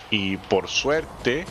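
"por suerte" drawn out slowly, each syllable weighted; stress on por suerte. Shown as a demonstration. Gamer stressing how lucky the jump was, slow "por suerte". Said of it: "mucho énfasis en por suerte".

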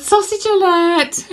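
high, slow giggly sausage alert; laughing. Real, not acted. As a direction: squeak it out through laughter. Giggling repeat of sister's joke.

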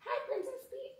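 very high, soft doll voice; Barbie. Pretend. Mom's doll greeting Princess Peach, tiny high voice. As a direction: squeak it softly like a tiny doll. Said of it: "Mommy can't do that voice".

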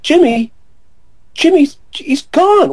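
very high, drawn-out exclamation; retold incredulous reply. Friend told of the search for Jimmy, high slow incredulous reply. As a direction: squeal it slowly in disbelief.

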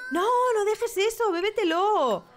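high, loud urgent plea; loud, excited cry at the game character; excited urging. Real, not acted. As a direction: pitch way up and beg the Sim to drink. Urging her Sim to drink it.

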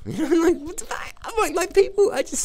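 laughing, very high what the fuck; high laughing exasperation; incredulous squeal. Real, not acted. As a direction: squeak out disbelief, pitch jumping way up. Laughing disbelief at critics.

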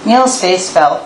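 usual speaking voice

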